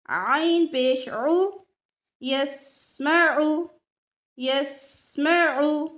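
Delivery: आला अनअमत आलमीन slowly with a throaty आइन; slow example words. Shown as a demonstration. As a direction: drill each word slowly. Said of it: "आइन की मिसालें". (आइन वाले लफ़्ज़ धीरे धीरे)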